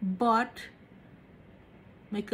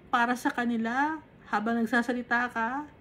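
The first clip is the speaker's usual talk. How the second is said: normal talking voice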